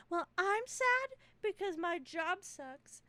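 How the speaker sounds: high, slow whiny complaint; hammed-up sad dinner guest pleading their case; mock self-pity. Friends competing for the last brownie, mock self-pity.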